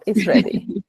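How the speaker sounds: a teasing stage whisper; playful whisper. Pretend. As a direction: susurro juguetón y cómplice. Host teases group is eager.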